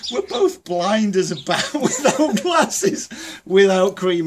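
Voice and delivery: laughing through the words, slowed; cracking up mid-sentence. Real, not acted. Pie in face, laughing.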